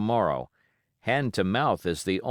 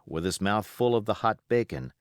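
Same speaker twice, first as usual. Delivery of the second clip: ordinary delivery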